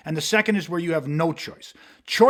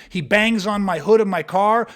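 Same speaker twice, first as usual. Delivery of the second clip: normal talking voice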